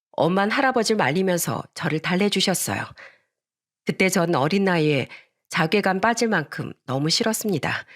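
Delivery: ordinary delivery, nothing marked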